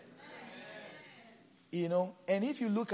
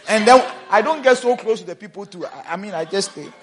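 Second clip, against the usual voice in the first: loud, high, fast preaching; full-force preaching; 6 semitones higher, 13 dB louder than usual. Acted. Pastor telling about stained shirts, loud and high. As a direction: preach it out, raised and quick.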